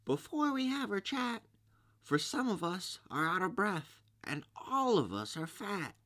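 very high, squeaky creature voice; crying-out little oysters. A narrator's reading. Out-of-breath oysters plead for a rest, high squeaky cry. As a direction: squeak the plea like tiny shellfish. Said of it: "the oysters cried".

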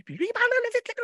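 high fast squeaky critter voice; squirrel voice. Acted. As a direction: pitch way up like a cartoon squirrel. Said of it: "Monsieur Lécureuil". (voix d'écureuil aiguë)

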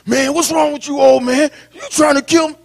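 put-on high, loud, alarmed young man; hammed-up panicked son; mock protesting son. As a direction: yelp it like a son who thinks he'll be killed. Son imagined protesting to father.

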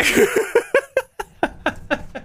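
breathy, high giggle; high-pitched laugh. Real, not acted. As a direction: burst into a squeaky laugh. Laughing at the story.

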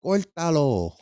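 put-on high Spanish-accented grandma voice; Spanish grandma coaxing her grandson, high voice. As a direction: coax like a Spanish abuela. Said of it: "You know my Spanish grandma".

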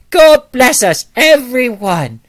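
put-on high, loud little-boy British accent; British Tiny Tim. Young boy auditioning as Tiny Tim, high, loud British accent. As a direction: belt it out slowly as a British child at an audition. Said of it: "can you do a British accent".

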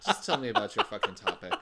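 laughing while talking. Real, not acted. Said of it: "I don't like that".

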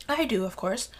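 normal talking voice

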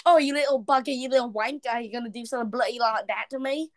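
hammed-up British scolding, speeding up; mildly yelled British insults, put on; mock British accent. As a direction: rant in a fake British accent. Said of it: "screaming things like".

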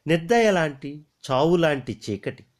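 ordinary delivery

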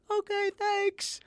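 very high, slow, small voice; squeaky mock reply. Trainee answering trainer's pull-up advice, tiny high voice. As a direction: answer meekly in falsetto.

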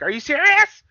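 piercing, high, loud mock shriek; Vicky voice. Retelling the wrestler's outburst to a co-host, shrill Vicky impression. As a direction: imitate her shrill piercing squawk. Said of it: "her Vicky voice".

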